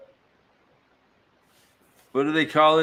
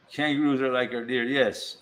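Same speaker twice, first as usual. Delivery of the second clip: normal talking voice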